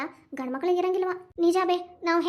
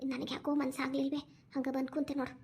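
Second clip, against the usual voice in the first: much softer, tearful and low; 3 semitones lower, 10 dB quieter than usual. Acted. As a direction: softly, close to tears. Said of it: "ಮೊದ್ಲು ಕಣ್ಣೀರ್ ಒರ್ಸ್ಕೊವ".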